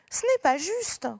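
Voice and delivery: angry protest. Shown as a demonstration. Pipioli angry at unfairness, high loud protest. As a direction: cry out at the unfairness. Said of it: "Pipioli est en colère".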